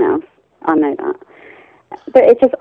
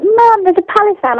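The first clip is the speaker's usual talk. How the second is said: put-on excited kids; 13 semitones higher than usual. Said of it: "the kids were like".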